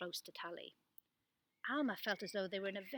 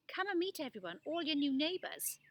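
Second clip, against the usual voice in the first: cheerful welcome; 7 semitones higher, 4 dB louder than usual. Acted. Boy welcoming them.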